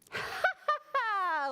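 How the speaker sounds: high, hearty ha-ha-ha; high storybook laugh, scoffing; voiced laugh. A narrator's reading. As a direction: laugh boldly as the fearless spider. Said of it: "laughed Spider".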